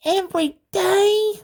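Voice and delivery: put-on high, slow small child's voice; a child explaining earnestly in a high voice. A narrator's reading. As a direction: speak up high like a little kid. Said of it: "Megan asked and stopped".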